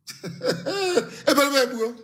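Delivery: high, chuckling scoff; derisive laugh. Real, not acted. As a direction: break into a high, scornful laugh. Derisive laugh before cancelling protest order.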